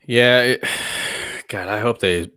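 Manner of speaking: ordinary delivery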